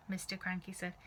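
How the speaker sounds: ordinary delivery, nothing marked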